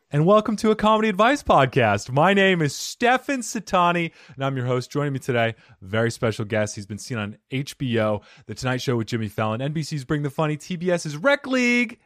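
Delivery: loud, upbeat show host intro; announcer intro. Acted. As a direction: pump up the guest introduction. Hosts the podcast intro.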